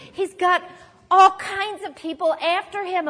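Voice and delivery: ordinary talk, nothing marked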